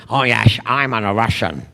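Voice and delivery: low Scottish slur with shushed s; Sean Connery. Retold or imitated. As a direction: do an unchanging Scottish accent as a supposed Russian. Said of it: "he played the Russian".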